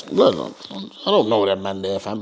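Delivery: low, slow, dismissive Brum voice; standoffish Birmingham youth. Retold or imitated. A Birmingham youth coldly dismissing London youths, low and slow. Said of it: "Birmingham's got this mentality, it's like".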